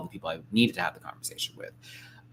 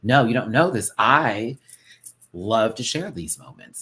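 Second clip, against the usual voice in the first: emphatic retort; 2 semitones higher, 8 dB louder than usual. Retold or imitated. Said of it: "but I'm like".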